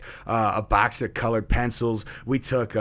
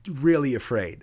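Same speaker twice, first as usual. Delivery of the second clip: usual speaking voice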